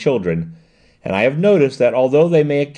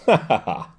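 hearty laugh. Acted. Dyer in the office, laughing at the fooled rascal. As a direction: laugh out the joke on the gang. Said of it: "made the office ring again with his merriment".

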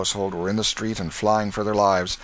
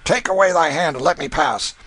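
curt demand; 9 semitones higher, 5 dB louder than usual. Acted. Canty demands passage.

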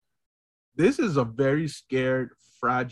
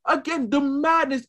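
ordinary talk, nothing marked

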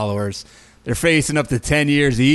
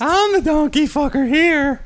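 much higher, slow bragging voice; mock boast; 12 semitones higher than usual. Mocking Kratz's boast, high and slow. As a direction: drag it out like a proud creep.

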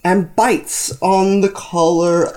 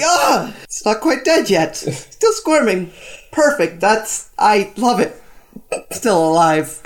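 gleeful shriek; 3 semitones higher than usual. Acted. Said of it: "shrieks a little".

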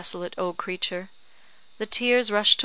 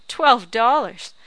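slow, much louder scoffing repeat; scornful shout; 4 semitones higher, 10 dB louder, slower than usual. A narrator's reading. Old woman mocks the husband's offer, loud and slow. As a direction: throw the sum back with contempt.

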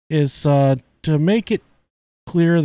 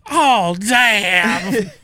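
higher, drawn-out mock dismay; imitated shocked grandpa; 6 semitones higher than usual. Grandpa finding mess on his hand, imitated dismay. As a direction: lightly mimic a grandpa's drawn-out curse. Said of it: "he said".

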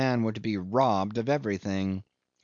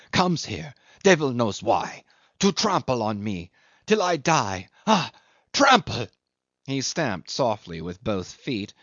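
bitter rant. A narrator's reading. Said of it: "without a trace of humility".

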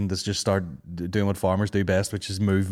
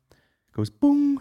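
mock boom sound; much slower than usual. Imitating app breathing sound.

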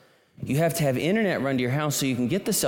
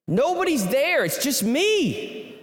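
mild panic; 11 semitones higher, slower than usual. Real, not acted. Alone with the cow in labor, rising mild panic. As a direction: sell the growing alarm of being alone.

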